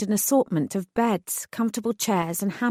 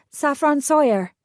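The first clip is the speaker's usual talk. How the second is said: a light Irish lilt on the name, higher; calling the name with a hint of Irish; faint Irish accent; 6 semitones higher, 4 dB louder than usual. Manager calls the applicant, faintly Irish accent. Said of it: "she asked in a faintly Irish accent".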